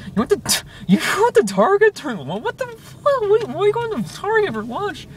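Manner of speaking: very high mock-outraged disbelief; hammed-up falsetto freak-out; imitating freak-out. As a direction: imitate a friend losing it, pitch shooting up. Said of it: "he's freaking out".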